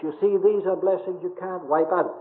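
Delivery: ordinary talk, nothing marked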